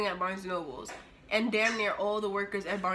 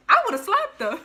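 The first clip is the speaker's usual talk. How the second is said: retold stranger; 10 semitones higher, 11 dB louder than usual. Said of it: "He was like".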